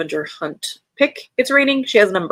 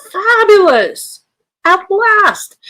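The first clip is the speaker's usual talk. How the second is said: high, slow delighted exclaim; thrilled, drawn-out praise; 12 semitones higher, 9 dB louder, much slower than usual. Real, not acted. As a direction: gush over it, much higher and louder. Delighted reaction to a title.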